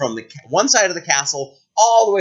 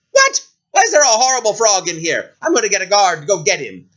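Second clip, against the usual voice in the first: high, loud disgusted shriek; disgusted princess; 6 semitones higher, 4 dB louder, faster than usual. Acted. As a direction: recoil from the warty frog.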